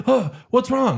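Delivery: re-enacted worry, high and urgent; retold gasp of concern; alarmed concern. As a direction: jump up in pitch, suddenly concerned for Mom. Retells alarmed reply to mom.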